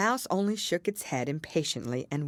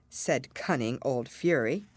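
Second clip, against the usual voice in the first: ordinary delivery, nothing marked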